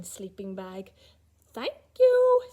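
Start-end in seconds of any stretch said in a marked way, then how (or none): 1.5-2.4 high, louder fox thanks; high fox voice, relieved; grateful fox. Acted. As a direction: chirp a bright thank you as the fox. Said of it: "said the fox".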